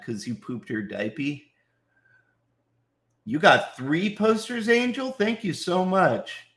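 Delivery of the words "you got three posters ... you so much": high-pitched grateful amazement; delighted surprise. Real, not acted. Streamer thanks a fan for three posters, delighted surprise.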